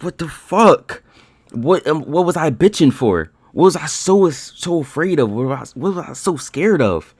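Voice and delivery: high, loud exasperated questioning; exasperated self-berating. Retold or imitated. Trader asking himself what he was so scared of, exasperated. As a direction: demand answers from yourself, pitched right up.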